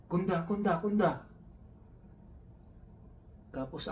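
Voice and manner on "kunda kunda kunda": imitating a coworker yelling "Kunda", much higher and louder; mock alarmed shout, high and loud; retold shouting. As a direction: call out "Kunda" like an alarmed yell, pitched up.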